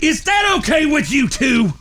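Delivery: high, shouted sarcastic question; exasperated demand. Acted. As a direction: yell it at both brothers, fed up. Sarcastic demand to his brothers.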